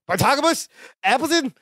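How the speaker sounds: high-pitched calling through the door; Gorpley. Retold or imitated. Guest calls from behind the door, high imitation. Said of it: "You hear Gorpley go".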